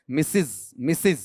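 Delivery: मिसिज twice, both vowels short i; correct pronunciation. Shown as a demonstration. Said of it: "इसका उच्चारण कैसे लूंगा".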